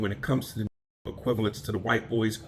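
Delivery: ordinary talk, nothing marked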